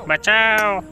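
mock cry for help, very high and loud; Shreeman Legend. Gamer mimicking a voice-pack plea, high mock cry for help. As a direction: wail it out like a voice-pack shout. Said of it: "Shriman legend किधर गए".

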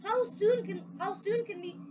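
can hardly wait; eager cry. Acted. The parents ask Lewis when they can go, thrilled. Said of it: "they cried".

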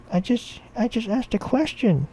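put-on high-pitched child's protest; little boy. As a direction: answer in a small, high kid voice. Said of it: "I'm eight".

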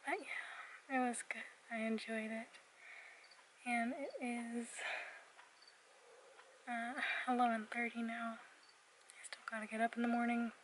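soft late-night whisper. Real, not acted. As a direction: keep it hushed, others are sleeping.

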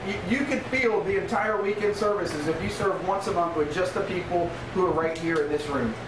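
normal talking voice

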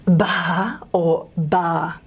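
slow, drawn-out ba then ba; breathy versus plain ba. Shown as a demonstration. Linguistics teacher demonstrating a breathy syllable contrast. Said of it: "whether you say".

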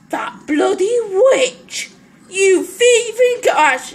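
slow, loud, high accusing cry; hammed-up fury at a thief; mock outrage. Player cursing the witch over spuds, mock outrage.

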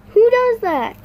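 loud, high, fed-up who does that; indignant cry at the camera; exasperated outburst. Real, not acted. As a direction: blurt it out in disbelief. Annoyed at getting erasers.

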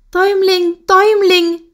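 put-on high, desperate cry for the boy; shrill distressed call across the flock; frantic calling. A narrator's reading. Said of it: "rief sie".

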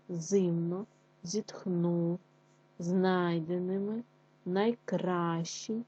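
slow, syllable by syllable with clear stress; careful word stress. Shown as a demonstration. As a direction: sound out each word, leaning on the stressed syllable. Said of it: "ставлячи правильно наголос".

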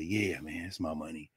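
soft, low-key reply from a tired man; imitating a man in a soft murmur; quiet mumble. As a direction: say it quietly, almost to yourself. Homeless man answering quietly.

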